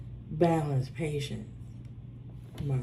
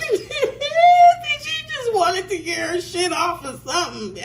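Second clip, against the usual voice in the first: laughing; 10 semitones higher, 5 dB louder than usual. Real, not acted. Cracking up recounting the banker.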